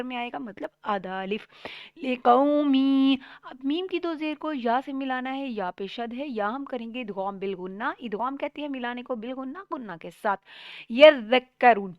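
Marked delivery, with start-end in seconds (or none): 2.0-3.2 slow, louder tajweed chant; slow recitation. Shown as a demonstration. Teacher reciting the word slowly for students. 10.9-11.9 یذکرون slow, ذ held and doubled; doubled zal. Shown as a demonstration. Teacher models یذکرون slowly with ذ doubled. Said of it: "آپ نے یا ذکرون نہیں پڑھنا".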